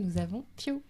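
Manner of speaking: usual speaking voice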